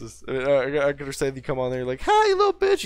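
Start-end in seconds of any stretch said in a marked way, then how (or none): 2.0-2.8 put-on very high squeaky kid taunt; squeaky little kid. Kid coming on voice chat, shrill squeaky taunt. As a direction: squeal it like a tiny trash-talker. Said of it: "You do sound like a little kid".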